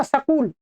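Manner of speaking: सकूल with a vowel splitting s and k; wrong pronunciation. Shown as a demonstration. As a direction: split it: स-कूल. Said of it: "स्वर भी नहीं जोड़ देना". ("सकूल", "स" और "क" के बीच "अ" डालकर)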